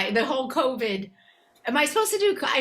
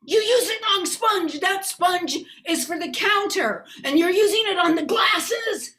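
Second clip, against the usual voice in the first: high, loud, nagging complaint; fussy boyfriend scolding; 8 semitones higher, 4 dB louder than usual. Retold or imitated. Boyfriend scolding her over the dish sponge, loud and high. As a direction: scold her like a neat-freak. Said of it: "acting piece".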